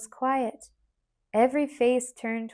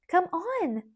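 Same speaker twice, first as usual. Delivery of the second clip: joyful; 7 semitones higher than usual. Acted. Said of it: "said Ruthie joyfully".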